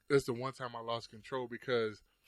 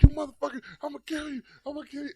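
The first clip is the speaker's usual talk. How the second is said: much higher, loud enraged threat; mock furious yell; 14 semitones higher, 10 dB louder than usual. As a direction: scream it like you want blood. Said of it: "I'm like".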